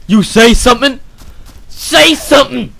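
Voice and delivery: loud, high demanding shout; yelling at him. Real, not acted. Gamer shouting at a silent villager to speak. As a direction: demand an answer at the top of his voice.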